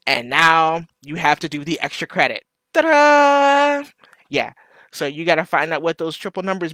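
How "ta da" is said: high, loud ta-da; showy high-pitched flourish; playful fanfare. Pretend. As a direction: belt out a mock-triumphant reveal. Mock fanfare for extra credit.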